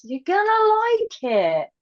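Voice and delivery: high singsong sales pitch; playful promise. Real, not acted. Guest plugging a zine to listeners, playful high promise. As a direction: lift it way up, teasing and sure.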